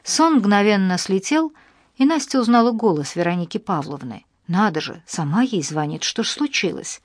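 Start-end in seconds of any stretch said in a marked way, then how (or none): none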